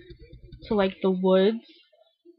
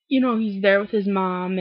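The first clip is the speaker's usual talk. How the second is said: ordinary delivery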